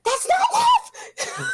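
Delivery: high, drawn-out screamed protest; retold scream. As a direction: re-enact screaming at the screen. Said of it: "I screamed. I actually screamed".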